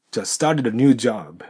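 slow, with "started a" flowing together; slow linked demo. Shown as a demonstration. Teacher modelling the whole sentence slowly, linked. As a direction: spell out the linking slowly for learners. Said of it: "startedのDとAがくっついて".

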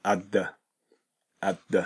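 "at the" with T absorbed into TH; swallowed T. Shown as a demonstration. Teacher shows similar sounds merging, "at the" without separate T. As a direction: let the TH swallow the T in "at the". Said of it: "後ろが前を飲む形で".

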